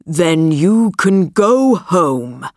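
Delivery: slow, distorted beast voice; deep distorted monster roar. Acted. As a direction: growl like a monster. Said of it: "said the beast".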